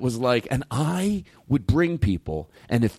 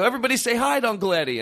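much higher, bright announcer call; a cheery emcee call, well above his usual voice; host announcing; 9 semitones higher, faster than usual. Retold or imitated. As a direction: hype up the room like a show host. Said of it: "and they go".

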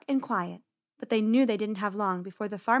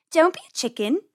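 cheeky taunt, pitched up; teasing; 6 semitones higher, 4 dB louder than usual. A narrator's reading. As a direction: tease your brother. Penny teasing her brother.

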